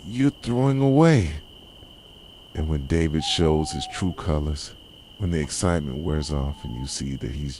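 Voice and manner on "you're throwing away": bitter outburst. Acted. Possessive lover confronting leaving partner.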